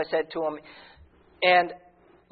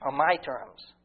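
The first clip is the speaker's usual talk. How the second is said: a hint of God's voice, softer and lower; voicing God gently; 4 semitones lower, 6 dB quieter than usual. God answering his people on his terms, lowered and softened. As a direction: speak as God, gentle and tender. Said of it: "And God said".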